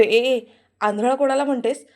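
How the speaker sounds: loud, slow, challenging snap; angry retort. Acted. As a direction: stretch it out to threaten her. Prithvi snaps back in quarrel.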